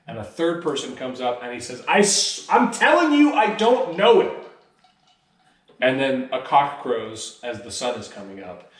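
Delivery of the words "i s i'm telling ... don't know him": high, loud insistent protest; cornered Peter denying much higher and louder; desperate denial. Retold or imitated. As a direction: swear you don't know him, rising in panic.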